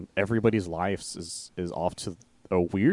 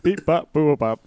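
high, loud mock robot beeps; playful vocal synth bleeps; imitated electronic beeps; 8 semitones higher, 7 dB louder, slower than usual. Co-host adding to the future song, mock electronic beeping.

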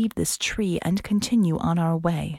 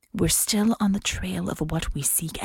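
plain reading voice

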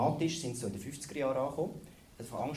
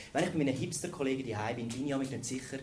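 normal talking voice

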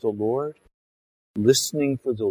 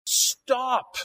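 high sharp command to halt; stern stop; 12 semitones higher than usual. Acted. Prophet rebuking Saul, high stern shout.

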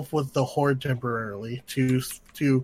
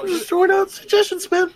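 much higher, squeaky falsetto; high-pitched voice; 3 dB louder than usual. Acted. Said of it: "Oh, there's my voice again".

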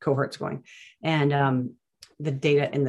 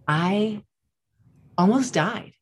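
raised pitch, drawn out with a suspenseful pause; dramatic retelling; 5 semitones higher, much slower than usual. Retold or imitated. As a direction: imitate her mildly theatrical announcement. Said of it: "just be all dramatic".

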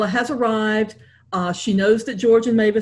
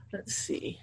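whispered to herself; half-whispered thinking aloud; 16 dB quieter than usual. Real, not acted. Author whispering to herself while choosing a reading length.

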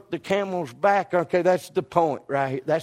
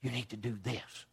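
much softer and lower, barely there; still small voice; 8 semitones lower, 17 dB quieter than usual. Acted. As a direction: voice the quiet inner prompting of God. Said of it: "that little still small voice".

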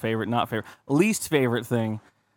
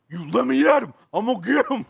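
high, mock-furious threat; a hammed-up hothead held back; mock fighting fury; 8 semitones higher than usual. Defending friends from rude staff, hammed-up fury. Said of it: "I, I'm like".

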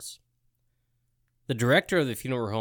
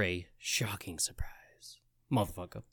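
breathy hush to end the clue; spooky whisper; 8 semitones lower, 11 dB quieter than usual. Acted. Quiz host whispers the spooky punchline to friends. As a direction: whisper it like a horror tease. Teasing horror trivia clue.

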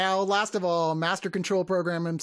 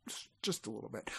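very soft, low, quick confession; hushed little apology; sheepish aside; 7 semitones lower, 14 dB quieter, faster than usual. Real, not acted. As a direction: admit it under your breath.

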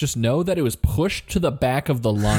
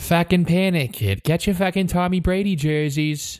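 hammed-up Boston accent, a bit higher; mock Boston sports fan; 5 semitones higher than usual. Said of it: "the Boston accent that we can do so well".

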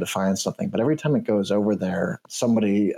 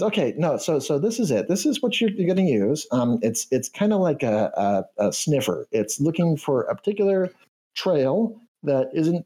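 canary voice; 6 semitones higher than usual. Acted. Canary NPC explains sniffer.

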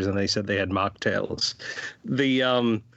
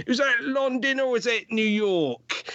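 British guard; 11 semitones higher than usual. Retold or imitated. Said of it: "He's like".

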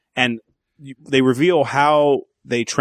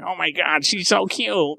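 put-on higher, gushing teen crush voice; smitten fourteen-year-old self; 4 semitones higher, slower than usual. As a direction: imitate your lovestruck younger self. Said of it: "when I was fourteen, I was like".

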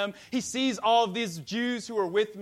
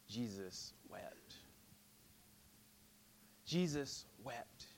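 slow, much softer, lower; hushed reverence; 9 semitones lower, 15 dB quieter, slower than usual. Acted. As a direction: hold each word in sorrow. Hushed reading of verse.

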